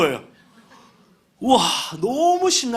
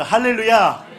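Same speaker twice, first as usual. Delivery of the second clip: shouted hallelujah; 6 dB louder than usual. Real, not acted. Preacher calls out to congregation.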